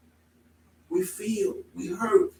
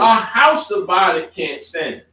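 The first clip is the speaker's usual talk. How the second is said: solemn proclamation; 8 dB louder, slower than usual. Real, not acted. Preacher declaims scripture.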